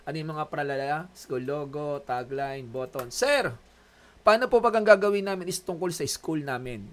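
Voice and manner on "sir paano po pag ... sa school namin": lightly acted student, higher and louder; student asking. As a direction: call out to Sir with an eager question. Acts out a student's question.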